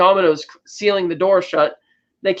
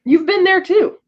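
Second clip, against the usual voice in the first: excited; 12 semitones higher, 3 dB louder than usual. Real, not acted. Excited reply to friend.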